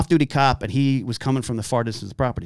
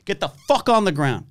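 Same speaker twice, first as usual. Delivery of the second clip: mock police command; 7 semitones higher than usual. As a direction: bark the order at the suspect. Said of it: "cussing is a use of force step".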